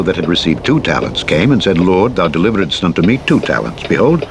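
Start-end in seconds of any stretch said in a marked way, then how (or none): none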